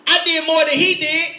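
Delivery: loud, high mock protest; indignant older brother. Older brother comparing himself to the prodigal, loud indignant protest. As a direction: complain loudly as the slighted brother.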